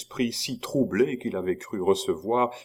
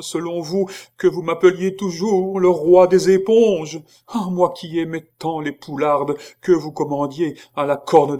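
high, loud lament rising to a cry; wailing monk; 8 semitones higher, 6 dB louder than usual. A narrator's reading. The monk wails his old feasts to Chicot. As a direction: whine out every good memory.

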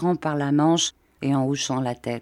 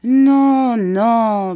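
consoling; 6 semitones higher, 8 dB louder than usual. A narrator's reading. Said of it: "d'un ton consolant".

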